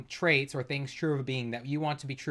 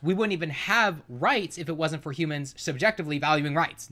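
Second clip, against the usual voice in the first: usual speaking voice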